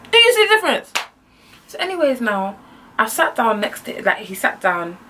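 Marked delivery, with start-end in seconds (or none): 0.1-0.9 high, loud mock demand; hammed-up high-pitched challenge; exasperated rant. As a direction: squeal the question in exasperation.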